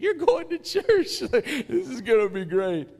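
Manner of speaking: high, slow teasing, laughing through it; mocking laugh. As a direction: gloat at the brother with a laugh. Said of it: "I was laughing. I was making fun of my brother".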